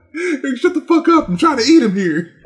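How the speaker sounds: high, loud mock-annoyed retort; hammed-up irritated cannibal, laughing; mock snap. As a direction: cannibal snapping at a teasing friend mid-meal. Acting out the mocked cannibal.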